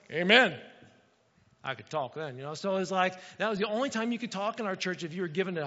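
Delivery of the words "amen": a loud, full amen from the pew; hearty amen. Retold or imitated. Congregant giving the one allowed answer, a loud hearty amen. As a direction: call it out like a proud churchgoer. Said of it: "the only time you talk in our church is if you're gonna".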